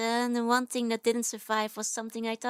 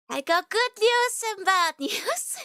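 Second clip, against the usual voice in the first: laughing; 9 semitones higher, 5 dB louder, slower than usual. Real, not acted. Teasing good and bad news.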